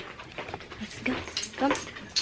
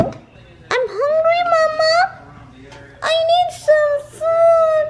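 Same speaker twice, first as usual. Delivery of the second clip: whiny hungry puppy. Pretend. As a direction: whine at Mama like a starving pup. Owner voices dog begging Mama.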